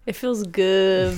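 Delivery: hammed-up sultry groan, slow and loud; over-the-top pleasure voice, playful; mock sensual moan. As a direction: ham up a sexy moan for the laugh. Mock sensual moaning joke.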